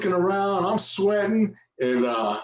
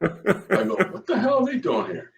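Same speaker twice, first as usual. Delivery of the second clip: high, laughing disbelief; 11 semitones higher than usual. Retold or imitated. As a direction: laugh through the question. Laughing retelling of surprise.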